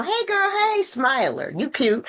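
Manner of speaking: bright high hello to a newcomer; playful greeter voice. Retold or imitated. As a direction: call out sweetly like a greeter at the door.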